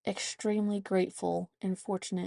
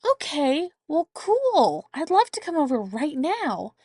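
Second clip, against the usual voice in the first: grandma voice; 8 semitones higher, 5 dB louder than usual. Retold or imitated. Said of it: "Grandma goes".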